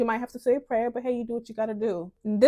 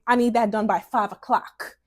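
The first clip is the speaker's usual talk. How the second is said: louder, blunt demand; abrupt and harsh; 5 dB louder than usual. Shown as a demonstration. Woman demanding a task be done by five, abrupt and harsh. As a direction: order it harshly, no softening. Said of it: "so abrupt and harsh".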